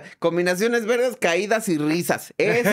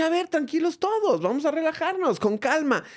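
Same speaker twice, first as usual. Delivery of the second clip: mock calming; 11 semitones higher than usual. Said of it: "Y entonces yo dije".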